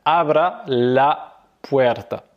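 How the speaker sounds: slow, drawn-out Spanish; careful teacher demo; slow model phrase. Shown as a demonstration. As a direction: model it slowly for the learner. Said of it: "donc tu vas dire".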